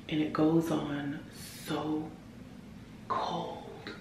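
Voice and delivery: soft, hushed voice fading toward a whisper; hushed, near whisper. Real, not acted. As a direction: keep it down for someone sleeping. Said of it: "I'm whispering because".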